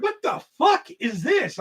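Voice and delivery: high, slow mock disbelief; amazed mom. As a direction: imitate stunned bewilderment, drawn out and high. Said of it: "she was just amazed".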